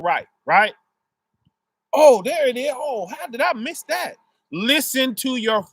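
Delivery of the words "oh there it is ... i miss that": a bright little gasp of finding it; surprised discovery. Retold or imitated. A believer finally spotting what God placed, higher and surprised.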